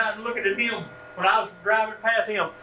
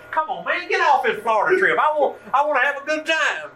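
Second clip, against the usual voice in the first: much higher and louder, pleading impatience; impatient teen; 9 semitones higher, 5 dB louder than usual. Retold or imitated. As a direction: whine like a teenager who wants his fun. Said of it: "I'm thinking to myself".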